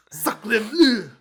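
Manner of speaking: high, over the top French exclamation; hammy French cry of dismay; French accent. Pretend. French sniper impression after beret.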